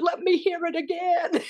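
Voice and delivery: high-pitched imitation of mom; demanding mother. Mother asking daughter for the dialect again, high imitated voice. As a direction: pipe up high as the unconvinced mother.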